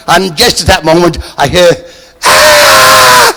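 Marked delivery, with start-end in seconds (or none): 2.2-3.3 sudden high unvoiced scream; distant fan shriek.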